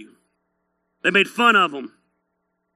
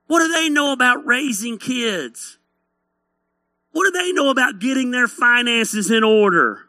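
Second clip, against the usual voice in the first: higher-pitched hillbilly sneering; hillbilly critic; 4 semitones higher than usual. Acted. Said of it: "Bunch of hillbillies criticizing Nehemiah".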